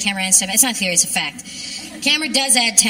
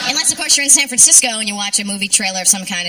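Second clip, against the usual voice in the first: usual speaking voice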